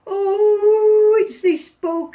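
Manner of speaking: a howling, woeful dog; unhappy dog. Acted. Dog complaining to George, high and wailing. Dog wails about the bog.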